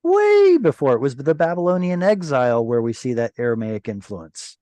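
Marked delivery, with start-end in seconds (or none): 0.0-0.9 squeaky insistence. Acted. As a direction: squeal it in playful protest. Playful insistence continues.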